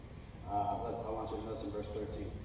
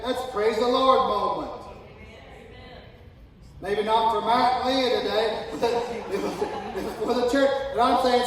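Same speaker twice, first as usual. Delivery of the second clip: loud, high, fervent pulpit preaching; fiery sermon, louder and higher, with bursts of laughter; preaching at full force; 10 semitones higher, 12 dB louder than usual. Acted. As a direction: preach it out to the whole congregation.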